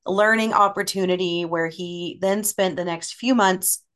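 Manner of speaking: usual speaking voice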